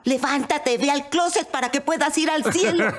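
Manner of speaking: high, urgent mock yell. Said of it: "Y yo le gritaba".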